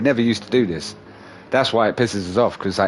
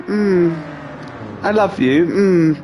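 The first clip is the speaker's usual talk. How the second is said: higher, cooing cat voice; needy cat's look; 6 semitones higher, much slower than usual. Retold or imitated. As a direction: imitate a cat asking for love. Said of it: "she looks at you and she goes".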